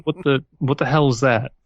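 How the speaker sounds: ordinary talk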